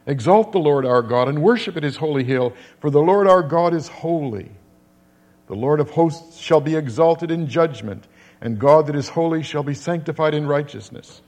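proclaimed scripture. Acted. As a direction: lift the verses up to the congregation.

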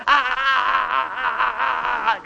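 high imitated yell of a screaming boy; boy running in screaming, high imitated yell; mock child scream. Son bursts into dad's phone call, high mock scream. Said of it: "Like he's screaming".

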